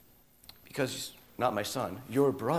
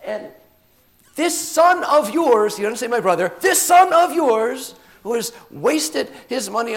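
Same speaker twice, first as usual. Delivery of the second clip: high, loud, aggrieved outburst on the edge of cracking; bitter protest; 12 semitones higher, 12 dB louder than usual. Acted. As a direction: get right in his father's face, voice straining. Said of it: "His voice cracks".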